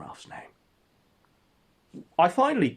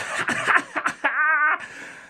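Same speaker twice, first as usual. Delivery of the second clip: shrill cartoon laugh; 5 dB louder than usual. Soundboard character laughing.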